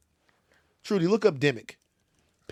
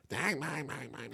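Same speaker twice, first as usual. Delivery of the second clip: low-volume mimicry of Southern twang; thick Southern accent; 3 semitones lower, 13 dB quieter than usual. Mother and daughter chatting in the elevator, imitated Southern accent. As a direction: imitate overheard Southern chatter under your breath. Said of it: "They have very thick Southern accents".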